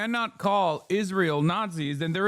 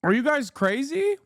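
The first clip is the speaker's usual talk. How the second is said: incredulous outburst; 9 semitones higher than usual. Real, not acted. Exasperated rant at chat.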